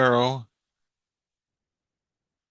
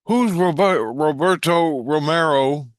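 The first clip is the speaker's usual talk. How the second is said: raised, high question called upstairs; calling out; 7 semitones higher, 4 dB louder, slower than usual. Retold or imitated. Sleeper asks kids above about a name, calling out. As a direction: call it up to them, louder and drawn out.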